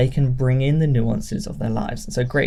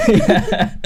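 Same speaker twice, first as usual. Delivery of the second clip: laughing; 10 semitones higher, 4 dB louder than usual. Real, not acted. Laughing reply.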